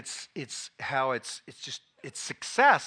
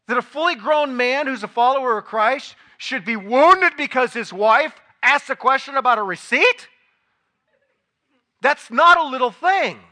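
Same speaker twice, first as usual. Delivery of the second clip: loud, higher, emphatic sermon rhetoric; preaching; 9 semitones higher, 12 dB louder than usual. Acted. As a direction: drive the point home to the congregation. Preaching at full force.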